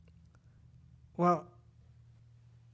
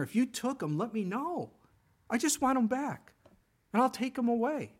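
higher, pleading woman's voice; Mary pleading with the gardener, higher and begging; 8 semitones higher than usual. Retold or imitated. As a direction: beg for his body back.

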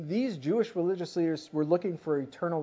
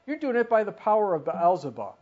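a raised, accusing charge; loud accusation; 4 semitones higher, 7 dB louder than usual. Retold or imitated. Religious leaders accusing Jesus, voiced loud and accusing. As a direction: hurl the charge at him like a hostile critic.

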